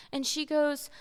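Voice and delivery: usual speaking voice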